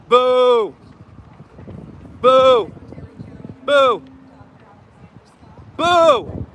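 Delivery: long drawn-out jeer. Real, not acted. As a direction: protest boo. Heckling at protest.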